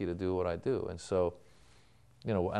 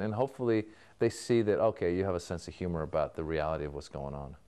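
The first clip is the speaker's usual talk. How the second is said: ordinary talk, nothing marked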